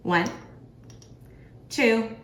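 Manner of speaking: ordinary talk, nothing marked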